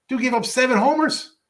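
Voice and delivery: can't believe the number; incredulous. Real, not acted. Repeats stat in disbelief.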